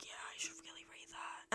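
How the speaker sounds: whispered under her breath; hushed whisper to herself; whispered aside. Retold or imitated. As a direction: whisper it like a private promise to yourself. Telling herself she should read books.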